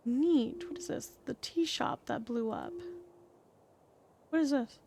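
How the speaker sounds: ordinary talk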